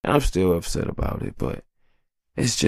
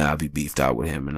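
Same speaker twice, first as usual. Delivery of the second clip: ordinary delivery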